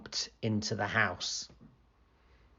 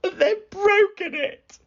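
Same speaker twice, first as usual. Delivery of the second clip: very high, slow wail; tearful tiny bear voice; upset squeaky Baby Bear; 4 dB louder than usual. Acted. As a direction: whine it out like a tiny cub. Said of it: "squeaked Baby Bear".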